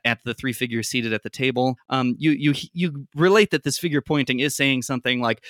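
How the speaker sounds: ordinary talk, nothing marked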